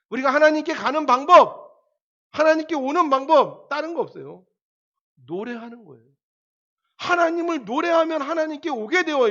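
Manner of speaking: loud, high exhortation to the congregation; fervent preaching. Acted. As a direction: preach it out with full fervour. Preaching at full force.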